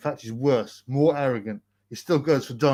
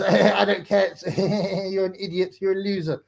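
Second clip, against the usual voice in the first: mocking the troll; 4 semitones higher than usual. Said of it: "he tries".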